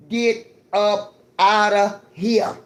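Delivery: stretched-out loud get up outta here; slow, loud dismissal to the privileged. Real, not acted. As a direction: drag out outta and push it loud.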